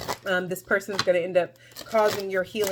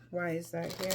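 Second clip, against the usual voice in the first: normal talking voice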